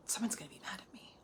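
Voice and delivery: fearful fast whisper. Pretend. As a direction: whisper it like a scared secret. Anxious people-pleaser inner voice.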